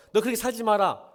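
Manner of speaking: loud, high scolding preacher; angry scolding. Acted. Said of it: "화를 내면서". (화내며 큰 소리로 꾸짖기)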